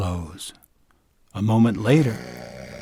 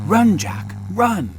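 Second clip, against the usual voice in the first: urgent warning; 8 semitones higher than usual. Acted. Giantess urging Jack to flee.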